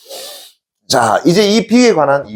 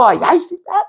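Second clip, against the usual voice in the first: high-pitched mock yell to vent; demonstrated yell. Shown as a demonstration. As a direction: yell it out as if letting off steam. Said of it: "있는 대로 없는 소리 쳐".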